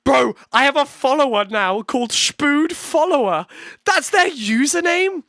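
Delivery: high, loud and incredulous; loud disbelief. Real, not acted. Streamer telling viewers of a follower's username, loudly incredulous. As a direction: exclaim in baffled amazement.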